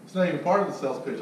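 normal talking voice

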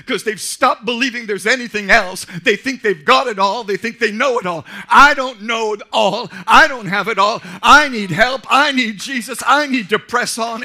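loud, driving pulpit cadence; preaching. Acted. Pastor to congregation, preaching at full force. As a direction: hammer each I need home.

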